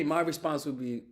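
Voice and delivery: ordinary talk, nothing marked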